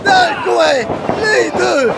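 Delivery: excited high play-by-play shouting; wrestling commentator. Acted. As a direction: call every move like a ringside hype man.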